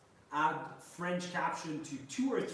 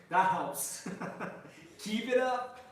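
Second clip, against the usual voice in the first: laughing; 7 semitones higher, 3 dB louder, slower than usual. Real, not acted. Laughing at a viewer's comment.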